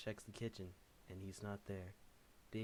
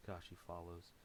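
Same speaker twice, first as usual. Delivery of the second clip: plain reading voice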